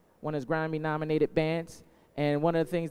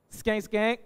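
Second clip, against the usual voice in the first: call; 7 semitones higher than usual. Acted. Calling to crowd.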